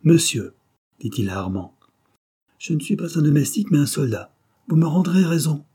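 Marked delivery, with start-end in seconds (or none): 0.0-0.5 high, loud haughty address; raised, defiant call; insolent. A narrator's reading. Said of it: "Il conserva toute son insolence". 2.6-5.6 higher-pitched haughty challenge; defiant, raised declaration; insolent. A narrator's reading. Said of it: "Il conserva toute son insolence".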